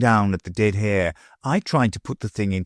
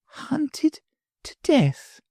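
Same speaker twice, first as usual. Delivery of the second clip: much higher, slow, stunned repeat; slow and sad; much slower than usual. A narrator's reading. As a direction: say it back to herself in sorrowful disbelief. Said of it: "very slowly and sadly".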